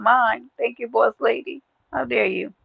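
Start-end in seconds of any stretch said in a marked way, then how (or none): none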